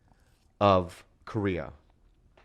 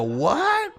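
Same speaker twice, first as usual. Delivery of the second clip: incredulous. Retold or imitated. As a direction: act out a stunned reply, pitched way up. Retold shocked reply to odd offer.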